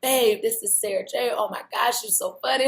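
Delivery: mocking the girlfriend's overdone praise; fake-sweet girlfriend. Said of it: "she's like".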